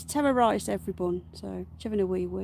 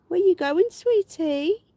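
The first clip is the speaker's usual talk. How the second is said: high, louder pet voice; cooing at pet; 9 semitones higher, 7 dB louder, slower than usual. Real, not acted. Owner calling after her rabbit in high, louder coo. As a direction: call after the bunny sweetly.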